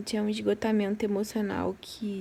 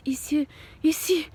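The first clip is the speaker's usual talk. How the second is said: slow, higher, worried what-if; retold sudden fear; 6 semitones higher, 4 dB louder than usual. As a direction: let a flash of fear slip into the question. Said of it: "me bateu um medo de". (medo repentino, lento e mais agudo)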